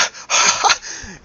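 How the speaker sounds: high, loud greeting laugh in character; overjoyed halfling laugh; delighted laugh. Acted. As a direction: burst into a high happy laugh. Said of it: "Arian's like".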